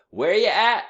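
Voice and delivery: high, loud answering call; calling back. A narrator's reading. Shorty answers his band with a high, loud call. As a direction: call it back across the avenue. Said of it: "Shorty answered".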